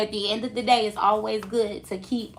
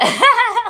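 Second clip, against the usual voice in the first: high laugh; 13 semitones higher, 10 dB louder than usual. Real, not acted. Laughing at friend's reply.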